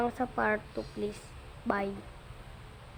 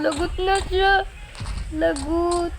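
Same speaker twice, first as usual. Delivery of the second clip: excited; 6 semitones higher than usual. Real, not acted. Chased by Blue in game.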